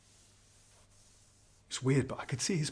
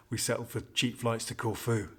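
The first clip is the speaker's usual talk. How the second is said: usual speaking voice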